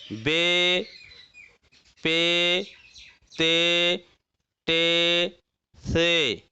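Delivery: letter names ending in a long "ay", बे, पे, ते; majhul Urdu letter names. Shown as a demonstration. Teacher shows students the Urdu letters, majhul long "e". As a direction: hold the open "e" vowel in each of बे, पे, ते, टे, से. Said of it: "मजहूल पढ़ेंगे।".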